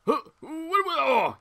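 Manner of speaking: high, loud startled cry. Real, not acted. Gamer startled by the sudden scare, high and loud. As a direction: jolt as if woken.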